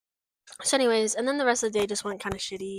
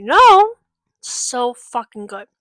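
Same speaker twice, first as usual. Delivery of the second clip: excited outburst; 2 semitones higher, 11 dB louder, much slower than usual. Real, not acted. Fan gushing to viewers about the show, loud squeal.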